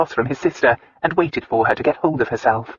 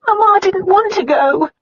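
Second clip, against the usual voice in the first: high, sobbing voice; crying; 5 dB louder than usual. A narrator's reading. Sister sobbing to her brother, high. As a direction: cry the words out again.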